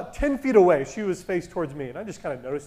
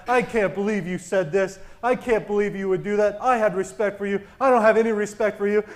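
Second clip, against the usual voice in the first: ordinary talk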